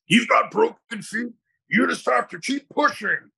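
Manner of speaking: high, hammed-up push-through-pain coach voice; tough-love drill sergeant. Mocking the no-excuses fitness crowd, in a high put-on coach voice. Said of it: "We need people like Goggins that are like".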